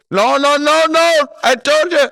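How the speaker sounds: hammed-up shriek of a phone scammer losing patience; screeching scam caller. Scammer yelling back at the caller, hammed-up high shout.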